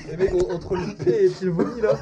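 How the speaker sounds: laughing. Real, not acted. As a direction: giggle through it. Laughing at smell.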